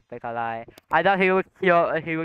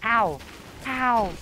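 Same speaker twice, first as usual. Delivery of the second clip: mock pain yelps; 7 semitones higher than usual. Hit in the game.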